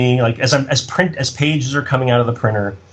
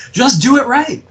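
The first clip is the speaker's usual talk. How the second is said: high exasperated plea, self-mocking; complaining blowhard; 12 semitones higher than usual. Writer mocking himself complaining to production, exasperated. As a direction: play up your own griping, pitched way up. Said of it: "constantly, like, a blowhard".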